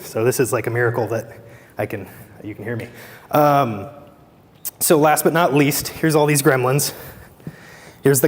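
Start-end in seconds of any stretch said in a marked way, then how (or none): 0.1-2.9 hoarse, fading voice; strained, worn-out voice; losing voice. Real, not acted. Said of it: "I'm losing my voice".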